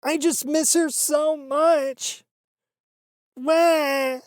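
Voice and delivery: high, slow mock wail; hammed-up crybaby wail; mock sobbing. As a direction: exaggerate the fake crying. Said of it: "I am mocking her".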